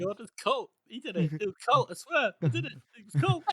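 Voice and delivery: high-pitched mock eagerness, repeating; over-trained witness. Coached witness blurting his answer to the questioner, over-eager. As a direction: parrot it like an overeager pupil. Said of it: "repeating the same thing".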